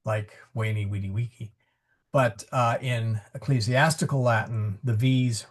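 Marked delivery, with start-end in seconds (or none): 0.6-1.5 "Veni, vidi, vici" as way-nee, wee-dee, wee-kee; W instead of V in "Veni, vidi, vici"; classical W sound. Shown as a demonstration. Teacher demonstrating Caesar's pronunciation with W sounds. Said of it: "a W sound".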